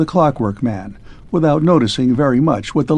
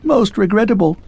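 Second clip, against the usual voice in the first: higher, jerky mechanical man; a hint of a clockwork man, higher; clipped clockwork man; 8 semitones higher than usual. A narrator's reading. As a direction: rattle it off like a faulty machine.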